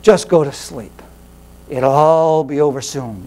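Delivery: low, slow, soothing tempter; lulling devil. Retold or imitated. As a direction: croon them to sleep like a sly tempter.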